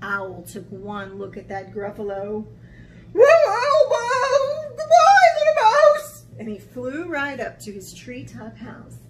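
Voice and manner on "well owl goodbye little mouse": very loud, high screech; terrified owl. Acted. Owl fleeing at sight of Gruffalo, shrieking panic.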